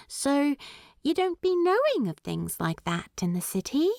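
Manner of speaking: lightly voiced Primula, puzzled and quick; Primula's voice.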